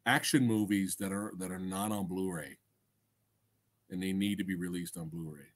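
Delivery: usual speaking voice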